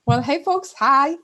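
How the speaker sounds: bright, loud, high hello to the audience; excited greeting. Real, not acted. Guest greeting the webinar audience, excited and loud. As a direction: greet the whole room with a big burst of energy.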